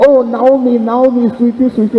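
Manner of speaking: high, syrupy mock cooing; cooing neighbours. Neighbours greeting returning Naomi, high mock cooing. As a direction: coo it like a fussing neighbour. Townsfolk fussing over Naomi.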